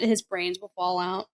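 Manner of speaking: usual speaking voice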